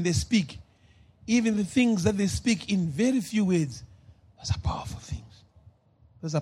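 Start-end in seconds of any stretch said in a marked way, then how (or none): 4.4-6.4 a soft unvoiced whisper for weight. Real, not acted. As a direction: whisper it to make it land. Preacher whispers for emphasis.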